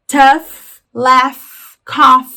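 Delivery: "tough", "laugh", "cough" punched out loud, each ending in F; loud word-by-word model of "tough", "laugh", "cough", final F on each. Shown as a demonstration. Teacher recapping the examples, "tough", "laugh", "cough" pronounced with final F. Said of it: "O G H ter o som de F no final".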